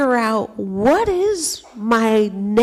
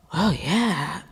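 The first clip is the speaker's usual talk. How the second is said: impressed gasp; 2 semitones lower, 7 dB quieter than usual. Retold or imitated. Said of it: "they go".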